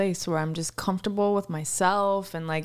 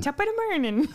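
a higher, laughing stab at an Irish brogue; bad Irish accent; 7 semitones higher than usual. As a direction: bursts out laughing mid-brogue. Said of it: "It's a horrible accent".

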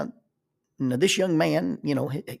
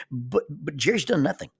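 ordinary talk, nothing marked

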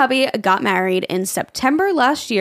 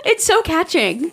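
high, giggly repeat; laughing; 11 semitones higher, slower than usual. Real, not acted. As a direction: say it while cracking up. Laughing fit over yawning.

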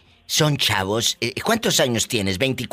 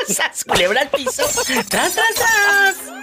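catchphrase cry; slower than usual. Acted. Host shouting his catchphrase after the husband's story, high and slow. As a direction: stretch each tras up high.

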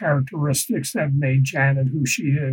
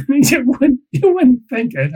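laughing; 8 semitones higher, 6 dB louder than usual. Real, not acted. Laughing aside on her humor.

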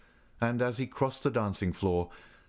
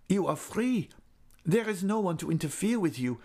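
put-on high, urgent pleading young man; Raoul voice; 8 semitones higher than usual. A narrator's reading. Raoul pleading with Christine to explain, urgent. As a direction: beg her to explain.

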